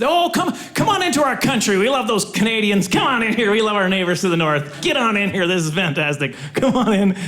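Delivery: hammed-up gushing American host; effusive welcome. Americans welcoming Canadians in good old days.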